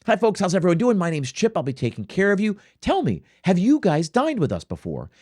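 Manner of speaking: bright, friendly server greeting; model server greeting. Shown as a demonstration. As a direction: greet the table like a pro waiter. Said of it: "you have to walk over to the table and say".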